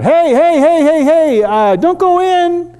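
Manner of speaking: high, loud calling out; shouting across. Retold or imitated. Calling to the stuck neighbor through the trees, a loud high shout. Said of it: "I shout".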